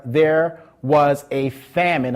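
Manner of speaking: slowed, weighted "famine"; stress on famine. Shown as a demonstration. Preacher having the congregation underline "famine", slowing on it. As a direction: drag out "famine" so they mark it. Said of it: "underline the word famine".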